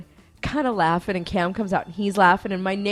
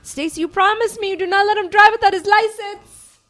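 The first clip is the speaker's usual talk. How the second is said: high, loud imitated mother's pleading order; insistent mom; 13 semitones higher, 8 dB louder than usual. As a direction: press hard for a promise, voice raised high. Mom insists Stacy promise.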